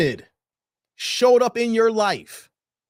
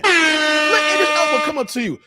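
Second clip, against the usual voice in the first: ordinary talk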